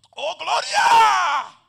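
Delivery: loud, high, very slow cry of glory; drawn-out shout. Retold or imitated. The preacher cries out after a push in his back. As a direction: stretch the cry out like rapture.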